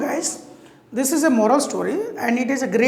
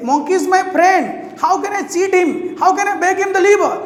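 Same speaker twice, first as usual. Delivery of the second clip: higher, louder protesting husband; upset refusal; 7 semitones higher, 4 dB louder, faster than usual. Acted. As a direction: cry out that he can't betray a friend. Crocodile refuses wife's demand.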